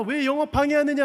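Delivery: high, loud retold accusation; mock outraged yell at intruders; yelled abuse. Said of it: "엄마가 막 욕을 지르면서".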